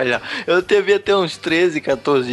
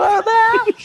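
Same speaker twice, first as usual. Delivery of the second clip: panicked high cry; slower than usual. Retold or imitated. Scared while fleeing turkey.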